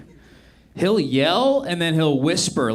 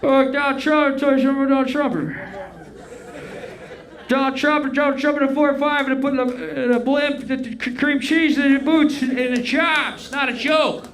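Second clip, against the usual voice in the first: put-on high, slurred mush-mouthed old man; mumbling Biden impression; 10 semitones higher than usual. Comics mocking a rambling president, high slurred mush. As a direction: garble the words like a confused old president. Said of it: "Everyone's like".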